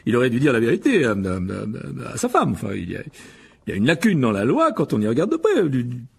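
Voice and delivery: stammering, hesitant self-justification; flustered excuse-making. Acted. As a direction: talk your way out, stumbling on words. Guilty husband stammers excuses to wife. (se justifier en bafouillant)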